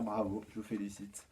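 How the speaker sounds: ordinary delivery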